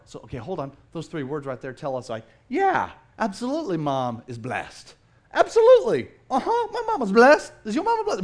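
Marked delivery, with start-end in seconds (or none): 2.5-8.2 high, loud, laughing, teasing agreement; playful Jesus. Retold or imitated. Jesus agreeing with the woman, playful and laughing. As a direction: play it as chuckling banter.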